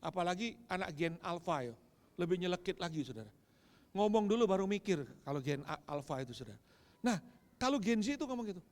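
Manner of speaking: ordinary talk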